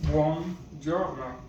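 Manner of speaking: buongiorno drawn out slowly, each syllable clear; slow clear demo. Shown as a demonstration. Teacher repeating buongiorno for the class, slowly and clearly. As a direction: spell out buongiorno slowly for learners. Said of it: "good morning is".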